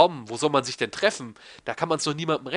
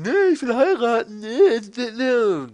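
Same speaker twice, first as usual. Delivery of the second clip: higher, louder whiny mock voice; whiny Ted; 8 semitones higher, 5 dB louder, slower than usual. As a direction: whine like an indecisive guy. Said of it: "Weil Ted ist mir immer so ein bisschen".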